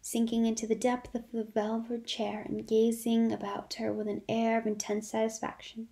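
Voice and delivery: usual speaking voice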